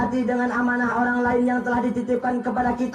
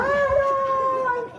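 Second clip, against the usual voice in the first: high hammed-up crying wail; mock wailing; much slower than usual. Wife hears the husband's prayer, hammed-up high wailing.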